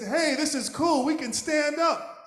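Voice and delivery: high, loud thrilled discovery; excited puppies. Acted. Puppies thrilled to find they can stand, high and excited. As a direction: burst out delighted.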